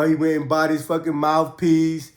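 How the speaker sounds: normal talking voice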